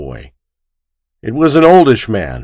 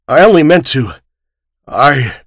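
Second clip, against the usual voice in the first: broken cry; 4 semitones higher, 4 dB louder than usual. Acted. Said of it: "He cried".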